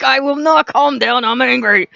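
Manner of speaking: loud, defiant shout back; mock angry retort. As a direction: refuse to calm down, hammed-up. Her own retort, shouting back.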